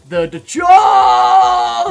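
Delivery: slow loud shocked yell; shocked shout. Real, not acted. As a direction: drag out a startled shout. Train crashes.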